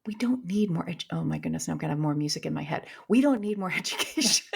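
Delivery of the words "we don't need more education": laughter breaking into the word; amused, laughing repeat of the point; laughing through it. Real, not acted. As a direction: giggle your way through the line. Laughing mid-repeat of her point.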